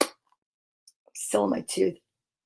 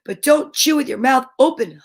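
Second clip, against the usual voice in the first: usual speaking voice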